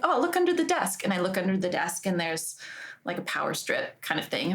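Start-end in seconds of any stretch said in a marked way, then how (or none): 0.0-1.0 bright self-advice. Retold or imitated. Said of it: "And I was like".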